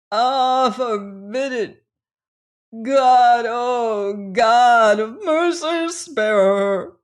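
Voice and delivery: loud, high plea to heaven; anguished cry. A narrator's reading. Resolution cries to heaven for the dying girl, loud and high. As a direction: cry out to God to spare her. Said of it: "he cried".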